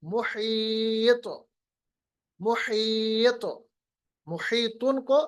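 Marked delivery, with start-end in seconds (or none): 0.0-3.4 slow मुहीत, long ई and echoed त; qalqalah demo. Shown as a demonstration. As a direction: stretch मुहीत, then bounce the त. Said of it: "क्या बनेगा?". (खींचकर मुहीत, तौ का क़लक़ला)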